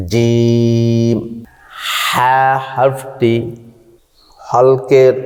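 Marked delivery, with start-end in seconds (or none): 0.1-1.2 জিম recited slowly and drawn out; letter demonstration. Shown as a demonstration. Teacher sounds the letter for students, slow and drawn out. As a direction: hold জিম long like Quran recitation. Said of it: "উপরের তালুর সঙ্গে লাগাইয়া".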